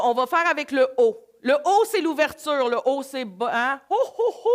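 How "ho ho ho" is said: a hearty, high mock Santa ho ho ho; Santa laugh. Showing the O vowel to the group, a light Santa laugh. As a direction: belt out a jolly Father Christmas laugh. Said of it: "Le O, c'est le Père Noël".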